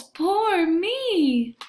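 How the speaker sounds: a mild self-pitying wail, pitched high. A narrator's reading. Lost Bear huddled by a tree wails, mildly pitiful. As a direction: cry it out like a sorry little lost bear. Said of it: "he wails".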